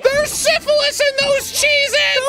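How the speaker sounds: loud, very high, slow scream; hammed-up desperate shout to warn someone; mock frantic warning. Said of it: "just trying to tell you".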